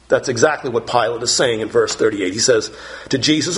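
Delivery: normal talking voice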